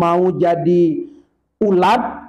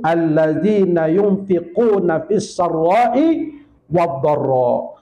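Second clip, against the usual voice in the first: slow chanted Arabic phrase; Quranic recitation; slower than usual. Acted. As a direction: stretch every syllable as in tilawah. Quranic verse quoted in sermon.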